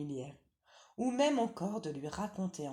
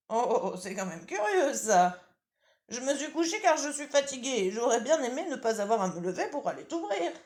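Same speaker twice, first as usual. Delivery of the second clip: high, loud granny voice with laughter; old grandmother chuckling; 8 semitones higher, 8 dB louder than usual. Acted.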